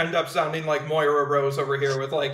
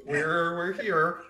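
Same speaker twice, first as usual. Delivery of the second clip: put-on slipped into Minnesota accent; 2 semitones lower, 7 dB quieter, slower than usual. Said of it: "That was my real voice".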